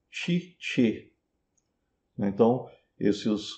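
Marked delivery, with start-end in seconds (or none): 0.1-1.1 "Cice" as chee-cheh, syllables separated; Italian syllables. Shown as a demonstration. Teacher isolates first syllables, Italian ch sounds in "Cice". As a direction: say "Cice" with two soft ch sounds. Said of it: "pronunciadas em italiano".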